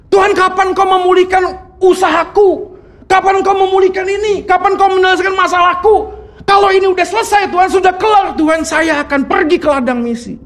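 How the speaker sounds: demanding prayer shout. Acted. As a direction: bargain with God at full voice, insistent. Mock-pleading demanding prayer to God.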